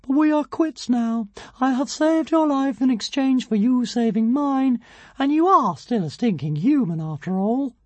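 high tiny dragon, mildly sorrowful; a touch of regret, tiny voice; Zigarastica, sorrowful. A narrator's reading. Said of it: "said Zigarastica sorrowfully".

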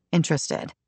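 usual speaking voice

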